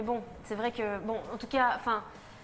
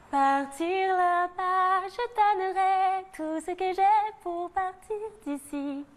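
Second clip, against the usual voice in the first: slow, dreamy Disney princess; 5 semitones higher, much slower than usual. Pretend. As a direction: sing it like a fairy tale heroine. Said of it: "Vas-y".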